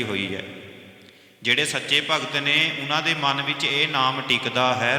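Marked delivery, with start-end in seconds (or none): none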